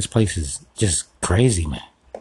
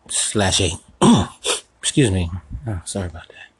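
ordinary talk, nothing marked

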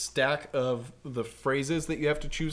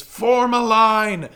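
drawn-out high commanding bellow; really big voice; 8 semitones higher, 13 dB louder, much slower than usual. Retold or imitated. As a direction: shout the order like a battle leader. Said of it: "his really big voice".

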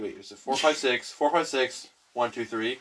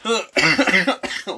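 choking on drink; 8 semitones higher, 6 dB louder than usual. Real, not acted. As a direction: still choking, strained after coughing. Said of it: "choking on my drink".

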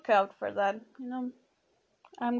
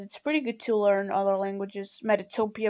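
ordinary talk, nothing marked

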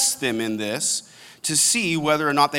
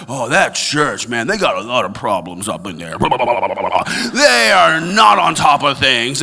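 griping churchgoer; 5 dB louder than usual. Retold or imitated. Pastor imitating a jaded churchgoer, dismissive grumble.

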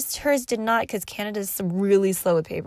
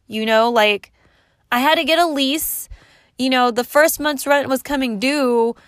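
loud, higher, indignant retold rant; imitating her own furious outburst; retold angry rant; 4 semitones higher, 6 dB louder than usual. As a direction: let loose on the managers who strung you along.

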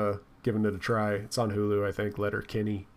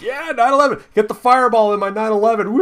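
mock rowdy party cheer, much higher and louder; rowdy partier; 7 semitones higher, 7 dB louder than usual. Mocking drinkers hyping their shot, loud rowdy cheer. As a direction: whoop it up like a hyped bro at a bar. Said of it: "they were just like".

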